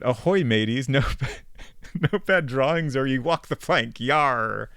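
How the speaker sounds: hearty pirate growl, playful. Pretend. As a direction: ham it up like a pirate captain. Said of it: "Why'd you say it like a pirate?".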